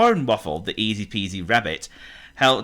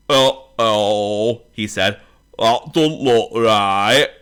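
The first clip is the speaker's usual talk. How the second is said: mammoth voice; 6 dB louder, much slower than usual. Acted.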